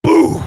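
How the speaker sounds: sudden loud high boom; explosive, high-pitched sound effect; imitated blast. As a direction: burst out with an explosion noise. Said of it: "sucked out of me and went".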